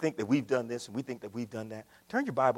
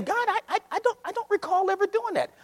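higher, feigned innocent whine; mock innocent denial. Church denying to God, hammed-up higher voice. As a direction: play the church denying it all.

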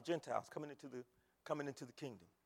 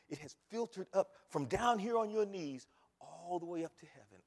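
ordinary talk, nothing marked

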